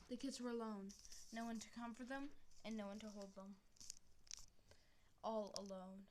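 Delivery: plain reading voice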